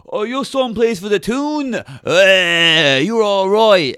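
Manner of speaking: a hint of a Newcastle lad cheering; Geordie lads. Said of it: "a bunch of Newcastle lads like".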